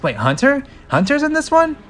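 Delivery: high, loud disbelief; real surprise. Real, not acted. Reader finds Hunter in script, real surprise. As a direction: blurt it out in surprise.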